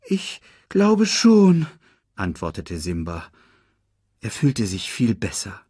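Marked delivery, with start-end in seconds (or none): 0.1-1.7 high, slow, hesitant reply; young lion cub. Acted. Cub answering after his faint, high hesitant voice. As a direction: answer haltingly in a raised cub voice.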